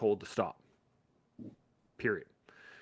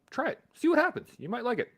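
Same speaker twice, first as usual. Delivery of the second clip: urging encouragement; 13 semitones higher than usual. Real, not acted. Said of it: "Go to a fucking gym and try it".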